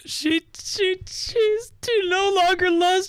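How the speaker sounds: very high-pitched, drawn-out pretend wail; mock screaming. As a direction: scream it high, just kidding around. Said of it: "when I scream into the microphone".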